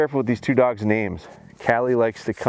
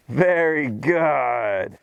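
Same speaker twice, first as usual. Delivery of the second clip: slow praise; much slower than usual. Real, not acted. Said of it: "you see how my voice and my excitement".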